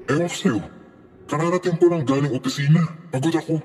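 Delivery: high-pitched, exasperated brush-off; irritated and fed up, pitch held high; angry husband. A narrator's reading. Tired husband just home from office rebuffing wife, exasperated. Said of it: "Sa galit ni Richard".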